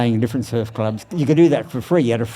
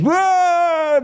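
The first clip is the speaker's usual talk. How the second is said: crowd's whoa; 4 dB louder than usual. Retold or imitated. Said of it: "everyone goes".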